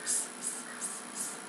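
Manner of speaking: limp, lazy unvoiced hisses; sloppy lazy s. Shown as a demonstration. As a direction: let each s sag sloppily. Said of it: "You don't want them to be like".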